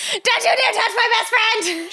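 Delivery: high, slow, loud battle cry; yelling. Acted. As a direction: charge in yelling to protect her. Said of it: "She yelled".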